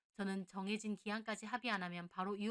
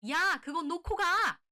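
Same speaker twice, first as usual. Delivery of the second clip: loud, high, drawn-out yell; shouting mother-in-law; 9 semitones higher, 12 dB louder, slower than usual. A narrator's reading. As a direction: holler the order after someone leaving. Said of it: "시어머니가 소리 지르더라고요". (버럭 소리 지르는 시어머니)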